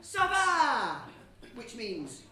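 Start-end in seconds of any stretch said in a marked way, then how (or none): 0.0-1.0 bright French call, louder and higher; shouted greeting. Shown as a demonstration. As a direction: call out Ça va to the crowd. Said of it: "I'm gonna shout".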